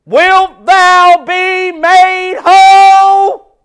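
very slow, loud and commanding; voice of authority. Acted. Jesus speaking to the lame man, slow commanding call. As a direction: proclaim it with full authority, drawn out. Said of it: "He speaks with authority".

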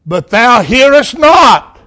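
psalm cried out in anguish; pleading cry of Jesus. Acted. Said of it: "He said in verse two".

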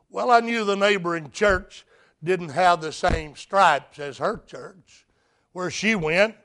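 normal talking voice

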